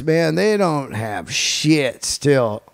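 ordinary talk, nothing marked

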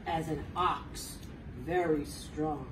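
low, slow, heavy and firm; slow strong flexing voice. Acted. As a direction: push it out like a strong ox. Acting out a strong ox.

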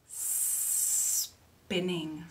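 SPINNING with a long hissed S; long snake sound. Shown as a demonstration. Teacher reads to children, long S. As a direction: stretch the front of spinning on the S.

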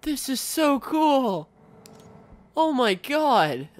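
high, stretched-out excitement; drawn-out, high-pitched awe; thrilled amazement. Real, not acted. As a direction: gush slowly with delight. Amazed by the map editor.

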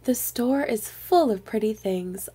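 ordinary delivery, nothing marked

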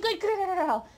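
loud, high-pitched praise; high dog praise. Real, not acted. Trainer praises puppy holding the bow. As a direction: reward the puppy warmly and loudly.

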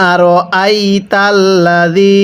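drawn-out Arabic recitation, slow; slow Quran recitation. Shown as a demonstration. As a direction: recite slowly for students to repeat. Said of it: "আরেকবার পড়বেন।". (ধীরে কুরআন তিলাওয়াত)

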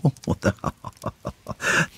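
put-on a soft, breathy hellish chuckle from the old man; mocking old man's laugh; burst of laughter. A narrator's reading. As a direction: laugh at the fool's wishes. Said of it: "Un éclat de rire partit de la bouche".

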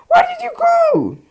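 very high, confused squeal; startled squeak. Real, not acted. Gamer squealing after losing sight of his enemy. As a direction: squeak it in disbelief.

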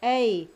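A held as ay; long vowel sound. Shown as a demonstration. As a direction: say A loud and long, as in paid. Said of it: "It sounds as A or E".

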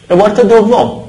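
ordinary talk, nothing marked

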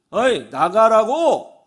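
acted slow, loud order; rude informal order. Man to woman phoning on the train, rude order. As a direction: order them out, imitating an irked passenger. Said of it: "대뜸 반말하는 거예요".

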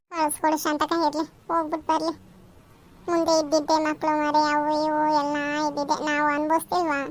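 lightly altered voice of a friend; advising neighbour. Acted. Neighbour urging mother-in-law to let her go, lightly voiced friend. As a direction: gently reason with your friend.

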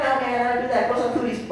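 high-pitched cracking-puberty protest; mock yelling back. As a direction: protest high, without real volume. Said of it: "I just kept yelling stupid shit".

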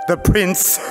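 ordinary talk

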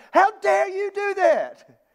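high indignant outburst at the church; angry Paul. Retold or imitated. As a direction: scold them like an exasperated apostle. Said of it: "He's angry. He's upset. He's frustrated".

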